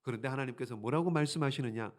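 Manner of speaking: normal talking voice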